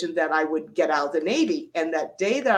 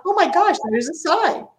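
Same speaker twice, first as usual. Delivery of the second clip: much higher and louder, thrilled; excited discovery; 11 semitones higher, 5 dB louder than usual. Retold or imitated. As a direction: burst out as if the sign just appeared. Sees the sign she prayed for.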